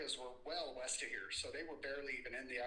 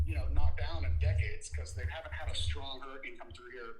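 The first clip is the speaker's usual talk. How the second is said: much louder, raised voice; shouting over wind; 12 dB louder than usual. Real, not acted. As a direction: speak up over the noise. Field reporter talking in storm prep.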